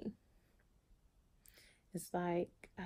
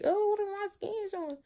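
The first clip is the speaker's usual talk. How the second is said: much higher, louder, fast alarmed squeal; mock alarm; 13 semitones higher, 5 dB louder, faster than usual. Scared to show her port, squeals in mock alarm, much higher. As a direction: yelp it like a startled worrier. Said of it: "I'm scared to take this off 'cause I'm like".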